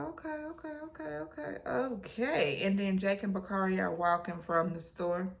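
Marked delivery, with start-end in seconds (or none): 0.0-2.6 soft, high string of okays; mock okays. Reacting to the pastor, soft high okays. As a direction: breathe a high little okay over and over.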